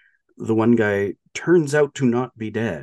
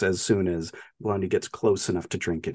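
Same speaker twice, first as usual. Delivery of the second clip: normal talking voice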